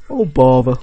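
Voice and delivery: Eeyore voice. Pretend. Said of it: "I thought it was like Eeyore".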